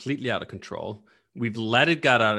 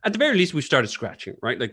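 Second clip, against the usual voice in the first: normal talking voice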